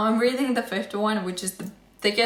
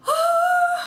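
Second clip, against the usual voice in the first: a long high swooning sigh; dreamy sigh; 6 dB louder than usual. Real, not acted. Thrilled vlogger swoons to viewers, dreamy sigh. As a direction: sigh like you're melting over the news. Excited about the Harry Potter play.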